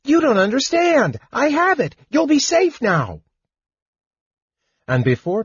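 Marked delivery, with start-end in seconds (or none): 0.0-3.2 very high, excited kender voice; Tass calling back. A narrator's reading. Kender assures friends of safety, high excited calling. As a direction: call out eagerly at the top of your range. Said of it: "Tass called back".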